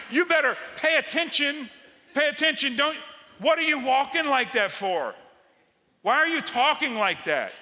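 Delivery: high, loud scolding; scolding shout. Acted. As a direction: shout it at them in mock rebuke. Preacher scolds the congregation.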